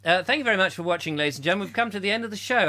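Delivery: fast, loud compère wrap-up; show host sign-off. Pretend. Thanking the audience, fast host sign-off. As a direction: rattle off the show closing.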